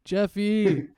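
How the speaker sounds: loud, high call of the name. Acted. Player calling out to Jeffy in character, loud and high. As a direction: shout his name to get his attention.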